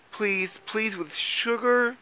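very high, drawn-out plea; begging. A narrator's reading. Mole begs Troll to come inside, pleading. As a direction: beg sweetly, pitched right up. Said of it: "begged Mole".

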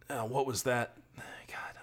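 hushed, dropping almost to a whisper; spooked near-whisper; startled hush. Real, not acted. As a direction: mutter it under your breath, spooked by what you just saw. Startled by something in the ditch.

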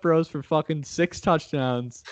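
high-pitched, emphatic trash talk; defiant boast. Real, not acted. Host vows to laugh in co-host's face, defiant boast. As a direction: hype the long shot with stubborn bravado.

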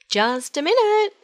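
high, loud hurried call. Acted. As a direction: call out the window in a rush. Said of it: "she called".

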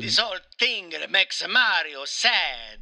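put-on slow, self-pitying Italian accent; Mario with Italian accent. Mario ends his complaint to the interviewer, mock-mournful in Italian accent. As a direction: lament slowly as a hammed-up Italian Mario.

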